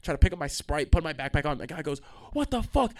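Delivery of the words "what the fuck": high-pitched mock outrage; raised-pitch mock yelling; imitated angry yell. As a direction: imitate an indignant driver. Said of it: "so he fucking yelling at me".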